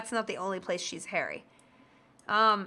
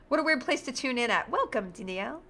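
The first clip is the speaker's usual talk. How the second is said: ordinary talk, nothing marked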